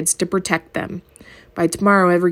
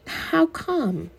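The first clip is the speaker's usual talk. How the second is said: sassy; 9 semitones higher, 5 dB quieter than usual. Acted. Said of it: "Her voice was sassy".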